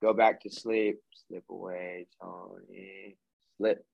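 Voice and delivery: trailing down to a near whisper, lulling him under; soothing hypnotist fade-out. Retold or imitated. Hypnotist easing his client back into the trance, softly fading. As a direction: coax him back to sleep, fading away.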